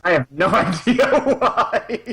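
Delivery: slow words through laughter; laughing. Real, not acted. Laughing mid-sentence.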